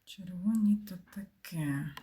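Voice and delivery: a slow, breathy aside under the breath; slow whisper. Real, not acted. Streamer repeating her remark to herself, in a slow whisper. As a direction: whisper it slowly to yourself.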